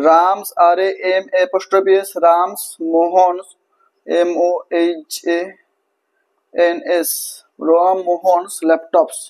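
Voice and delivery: slow, careful spelling out; slow dictation and spelling. Shown as a demonstration. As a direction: dictate slowly so they can write. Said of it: "dictating and spelling slowly".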